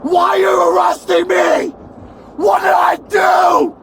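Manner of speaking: real angry shouts at the police driver; shouting. Real, not acted. Arrested passenger yelling at the silent driver, angry. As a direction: yell to make them answer.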